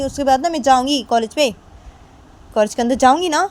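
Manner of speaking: loud, very high demanding rant. Acted. Kajal demands the scooter be moved first, loud high rant. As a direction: insist on your parking rights. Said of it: "तो तभी काजल बोलती कि".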